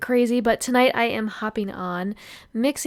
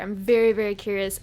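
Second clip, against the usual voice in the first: ordinary delivery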